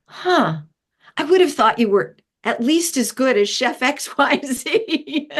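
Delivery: mock-puzzled dig at the chef; sly sarcastic needling. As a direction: goad the chef with fake innocence. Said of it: "We go".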